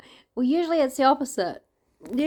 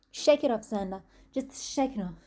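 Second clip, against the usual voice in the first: ordinary delivery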